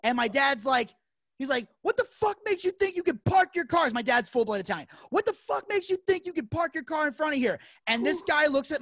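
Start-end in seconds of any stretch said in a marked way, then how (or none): 1.8-3.9 high-pitched, outraged impression of a father; retold angry outburst, pitched well up; fuming dad. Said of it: "my dad is fuming". 5.1-7.6 high-pitched, outraged impression of a father; retold angry outburst, pitched well up; fuming dad. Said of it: "my dad is fuming".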